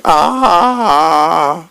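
a reader's restrained comic-strip scream; mock panicked scream. A narrator's reading. Zoe running crazy out to Mom and Dad, mock scream. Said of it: "here comes Zoe running crazy out, going".

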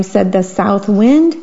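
ordinary delivery, nothing marked

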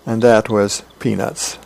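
plain reading voice

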